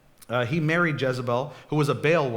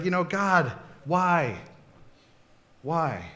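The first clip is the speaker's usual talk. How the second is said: mock despairing plea; 3 semitones higher, slower than usual. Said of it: "and he's like".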